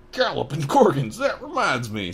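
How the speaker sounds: loud high startled cry; sudden exclamation. Acted. Hagrid remembering the owl, exclaiming loud.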